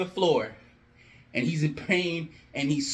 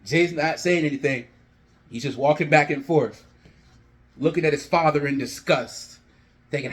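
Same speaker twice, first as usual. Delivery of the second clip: ordinary delivery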